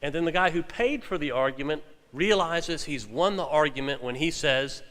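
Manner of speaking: ordinary delivery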